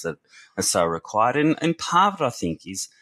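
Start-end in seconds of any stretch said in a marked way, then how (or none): none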